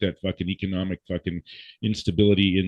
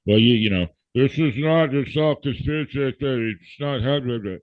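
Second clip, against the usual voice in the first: higher, whiny sneering parody of a know-it-all denier; mocking the doubters; 5 semitones higher than usual. Podcaster mocks conspiracy deniers, higher sneering parody voice. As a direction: scoff it out like a smug skeptic brushing off the news.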